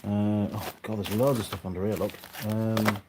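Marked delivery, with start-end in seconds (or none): none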